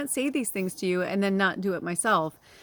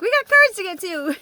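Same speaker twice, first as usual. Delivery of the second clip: brisk mock self; 11 semitones higher, 8 dB louder than usual. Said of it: "I'm like".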